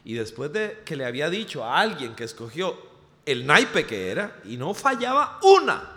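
ordinary delivery

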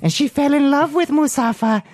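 Musafa voice. Acted. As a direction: squeak it out as the Jawa. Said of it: "talk in his voice".

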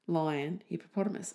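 usual speaking voice

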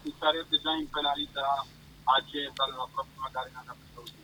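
faint, distant phone voice; speakerphone. Real, not acted. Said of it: "è a volume bassissimo lui". (in vivavoce, lontano e debole)

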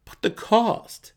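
a mercenary wriggling out of a request; Jarlaxle, evasive mercenary. Acted.

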